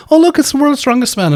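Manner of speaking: very high, excited mock voice; admiring relative. Family coming up to the strongman, in a high admiring voice. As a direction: squeal it like a starstruck relative. Family member greeting him, acted out.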